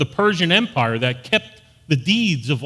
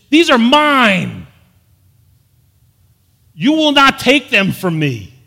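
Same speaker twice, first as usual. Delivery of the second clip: "These are mine" slow and much louder, each word pressed hard; emphatic claim; 5 semitones higher, 8 dB louder, slower than usual. Shown as a demonstration. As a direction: claim them with full force, slowly. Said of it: "No, this is".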